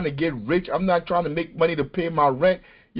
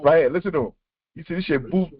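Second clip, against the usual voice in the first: usual speaking voice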